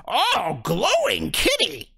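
high, slow, wondering creature voice; fish folk delight. Acted. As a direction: marvel at a shiny snack.

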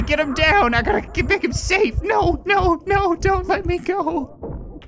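high, desperate shouting no. Real, not acted.

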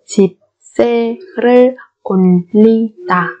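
slow 집세를 올리다 with gaps between syllables. Shown as a demonstration. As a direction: sound out 집세를 올리다 piece by piece. (đọc 집세를 올리다 chậm, tách từng âm)

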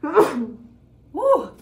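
loud sneeze with a whoop; sneezing. Real, not acted. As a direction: sneeze hard and cry out woo. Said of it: "I am just sneezing".

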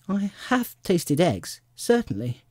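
honest girl, lightly voiced; candid little girl. A narrator's reading. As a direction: own up carefully in a lifted voice. Truthful Alice admits it.

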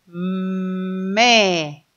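"me" with a long hummed m gliding into e; phonics-style stretched "mmme"; joining two sounds. Shown as a demonstration. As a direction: hold the m of "me" before the vowel. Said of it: "si juntamos los dos sonidos".

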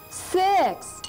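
high, clear count on the beat; count out loud. Shown as a demonstration. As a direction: call the number out loud. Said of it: "we're gonna say that one loud".

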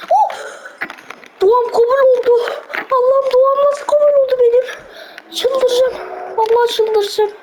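high, near-tearful cries of joy; half crying, half cheering at the screen; thrilled disbelief, voice cracking high; overjoyed disbelief. Real, not acted. Overjoyed the wish came true.

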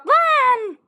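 loud, high "Lan!"; frustrated shout. Real, not acted. Gamer shouting after shooting the wrong person. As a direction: yell it in frustration.

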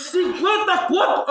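drawn-out, high disbelieving "Cinquenta conto?"; can't believe it cost fifty; shocked at the price. Real, not acted. Friend hears the dish's price, real shocked disbelief.

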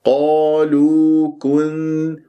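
slow recited قالوا كن- with even long vowels; natural madd. Shown as a demonstration. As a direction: lengthen both vowels of قالوا, then hum the n of كن-. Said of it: "مد طبيعي حركتين".

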